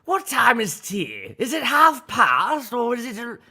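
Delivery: hammy, high-pitched English accent; Winston Churchill. Shown as a demonstration. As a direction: put on an exaggerated old English gentleman's accent. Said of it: "a Winston Churchill".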